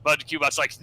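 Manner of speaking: normal talking voice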